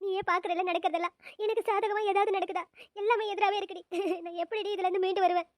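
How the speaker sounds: soft, hopeless weeping; sobbing despair. Acted. Bride telling her friend everything is against her, sobbing. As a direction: lament weakly through sobs.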